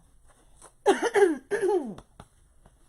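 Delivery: dry throat coughs; coughing on dust. Real, not acted. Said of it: "got dust in my throat".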